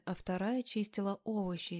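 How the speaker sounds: usual speaking voice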